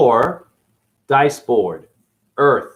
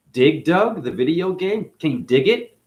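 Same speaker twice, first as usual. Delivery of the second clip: usual speaking voice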